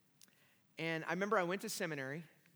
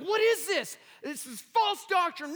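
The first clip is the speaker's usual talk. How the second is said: much higher and louder, scandalized cry; outraged; 14 semitones higher, 11 dB louder, slower than usual. Retold or imitated. Seminarian denouncing the book's false doctrine, loud outrage. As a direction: shriek it like an offended zealot. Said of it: "I was very upset".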